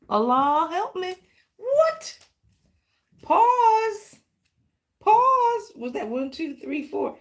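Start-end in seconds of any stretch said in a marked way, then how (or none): 1.6-2.2 high startled what; surprised. Real, not acted. Startled by the card she pulled. 3.2-5.7 high, loud pause; excited. Real, not acted. Announcing the card to her viewers excitedly.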